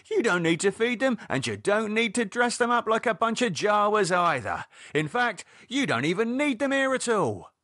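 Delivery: high, heated, insistent complaint; David arguing. Acted. David telling Lydia the guests aren't needed, exasperated. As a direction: pile one objection on the next.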